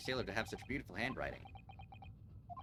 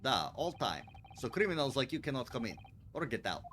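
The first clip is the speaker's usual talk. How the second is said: put-on Russian accent. Sailor guard.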